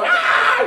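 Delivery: short high, loud shriek; sudden mock scream; imitated scream. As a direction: cry out mid-story like her. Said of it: "She started screaming kind of like that".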